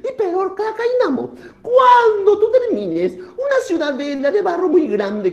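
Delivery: high, loud-edged deity voice; moon instructing. Acted. Moon instructs Takainamo on his task, high-pitched. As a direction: lay down the condition in a high put-on voice.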